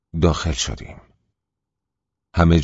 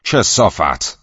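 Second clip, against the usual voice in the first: loud, higher furious yell; shout; 4 semitones higher, 6 dB louder, much slower than usual. Acted. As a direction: spit the insult out as a shout. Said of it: "فریاد زد".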